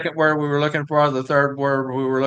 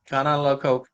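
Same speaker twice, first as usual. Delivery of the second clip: ordinary talk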